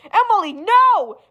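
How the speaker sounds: urgent protest; pleading shout. Acted. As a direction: shout her down. Stopping her revenge.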